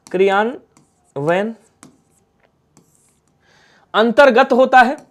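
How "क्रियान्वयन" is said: क्रियान्वयन drawn out very slowly and softly, ending with a rise; slow spelled-out word. Shown as a demonstration. Teacher writing the word on the board, very slow and soft. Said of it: "क्रियान्वयन होता है".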